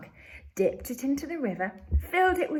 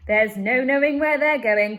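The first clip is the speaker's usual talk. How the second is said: loud gleeful cry with a hint of laughter; 4 semitones higher, 10 dB louder than usual. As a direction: cry it out, delighted by the chaos. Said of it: "cried Mister Wonka, hooting with laughter".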